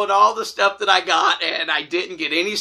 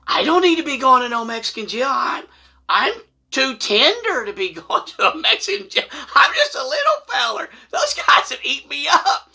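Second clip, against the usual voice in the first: comic alarm; 8 semitones higher than usual. Retold or imitated. Pleads fear of Mexican jail.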